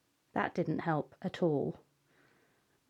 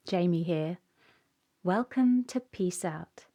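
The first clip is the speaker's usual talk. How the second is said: ordinary delivery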